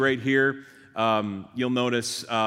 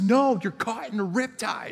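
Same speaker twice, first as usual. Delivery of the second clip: high-pitched urgent reply, only mildly raised; retold lifeguard call; 10 semitones higher than usual. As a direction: act out a lifeguard calling back. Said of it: "like, yelling at me".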